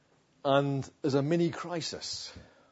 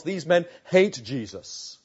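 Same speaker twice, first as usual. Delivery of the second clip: normal talking voice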